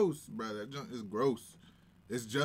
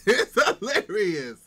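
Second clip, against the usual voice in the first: loud laughing exclaim; 6 semitones higher, 10 dB louder than usual. Real, not acted. Laughing at a funny misspelling.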